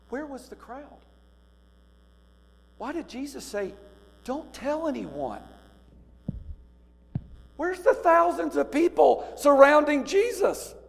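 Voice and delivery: high, pleading rhetorical questions building to loud; preaching at full force. Acted. Preacher challenging the congregation over the missing crowd, high and loud. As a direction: preach it, pressing the congregation for an answer.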